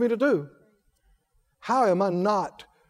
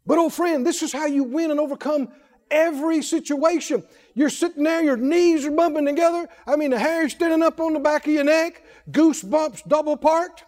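raised, high preaching voice running briskly on; impassioned preaching; 8 semitones higher than usual. Real, not acted. Preacher describing fear symptoms to a friend, impassioned. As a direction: pile up the details, pitched high and urgent.

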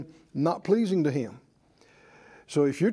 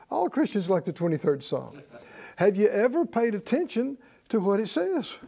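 normal talking voice

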